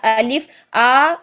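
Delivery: अलिफ slowed and stretched at the end; wrong drawn-out alif. Shown as a demonstration. Teacher shows students the wrongly stretched अलिफ. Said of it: "लंबा नहीं करना है".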